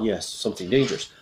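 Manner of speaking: usual speaking voice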